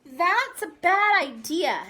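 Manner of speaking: put-on loud, slow, haughty child voice; bossy refusal. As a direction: shut the idea down firmly.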